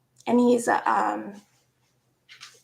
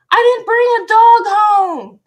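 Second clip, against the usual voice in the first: loud, high mock protest; high-pitched indignant yell; indignant retold shout; 11 semitones higher, 12 dB louder than usual. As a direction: shout it back in exaggerated disbelief. Retold protest at being yelled at.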